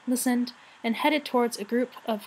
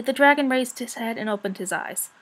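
ordinary delivery

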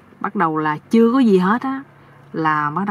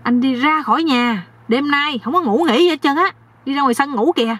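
mildly angry, higher-pitched wife's voice; scolding wife; 7 semitones higher than usual. Acted. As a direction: order him out in a raised pitch. Wife throws husband out. (giọng vợ mắng chồng)

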